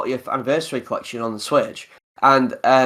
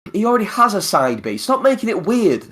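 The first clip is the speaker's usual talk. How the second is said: higher-pitched complaining protest; mock exasperation; 7 semitones higher than usual. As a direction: object as if fed up, half joking. Acts out own protest at move.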